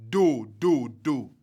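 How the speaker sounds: do, do, do sung low and level; low tone pattern. Shown as a demonstration. Teacher showing trisyllabic low tone pattern, low and level. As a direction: chant the three low tones evenly. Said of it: "And this is".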